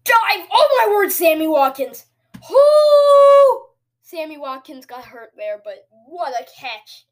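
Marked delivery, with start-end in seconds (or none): 0.0-2.1 high, very loud amazed shouting; thrilled shout. Real, not acted. Gamer seeing his receiver's big catch, thrilled shouting. 2.5-3.7 very loud, high, long whoop; celebratory whoop. Real, not acted. Gamer celebrating a big catch, very loud whoop.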